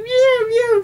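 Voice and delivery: whiny imitated cat meows; mock the cat's nagging meows; high mocking meow.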